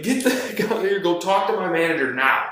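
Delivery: high-pitched indignant dismissal; re-enacted angry order. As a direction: send her away, reliving the argument. Re-enacts ordering coworker away.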